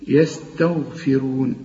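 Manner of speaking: يستغفرون slowly, heavy gh and full ra; tajweed recitation. Shown as a demonstration. Teacher slowly recites the word to show the heavy letters. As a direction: draw out يستغفرون with a thick غ. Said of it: "أهي الغين دي مفخم".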